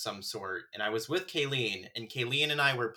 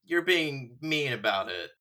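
drawn-out, higher-pitched mock pleading; retold protest; 5 semitones higher, 4 dB louder, slower than usual. Scolding the roommate for laughing at the sad scene, mock protest. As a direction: plead in playful reproach, stretching the words.

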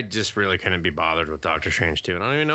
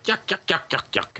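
high-pitched mocking yuk-yuk chatter; mock joker; 10 semitones higher than usual. Imitating Doctor Strange cracking jokes, as a mocking high yuk-yuk. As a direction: mimic an unfunny man's jokey patter. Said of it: "he's just like".

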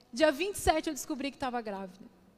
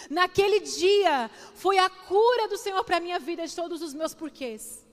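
raised, forceful preacher's climax; impassioned preaching; 4 semitones higher, 5 dB louder than usual. Real, not acted. Preacher declaring the Holy Spirit was there, impassioned preaching. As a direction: proclaim it to the whole church with conviction.

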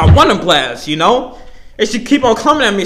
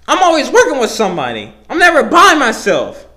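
ordinary talk, nothing marked

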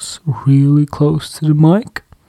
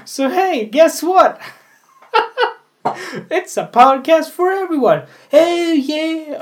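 high bouncy teasing; playful high; 11 semitones higher, 3 dB louder than usual. Acted. Teasing listeners who skip fashion, high and playful. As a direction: sing it up high to tease.

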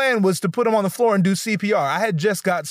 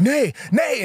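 panicked call; 8 semitones higher than usual. Retold or imitated. Shouting friend's name in panic.

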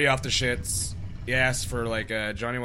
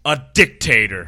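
put-on 1 drunk guest insult; 12 dB louder than usual. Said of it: "He was like".

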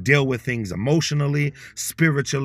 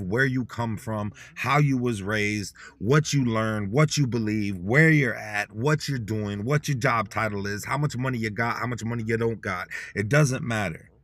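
usual speaking voice

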